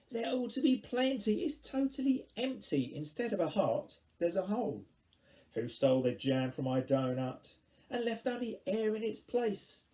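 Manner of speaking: high, dramatic rhythmic verse; indignant poem recitation. Acted. Poet performing a lament over missing jam, dramatic recitation. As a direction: declaim the complaint in big swoops.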